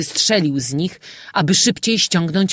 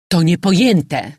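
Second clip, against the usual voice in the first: loud outburst of disbelief; exclaiming; 3 semitones higher, 7 dB louder than usual. A narrator's reading. Father reacting to his daughter's choice, exclaiming loudly. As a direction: cry out, louder than the narration. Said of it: "wykrzyknął ojciec".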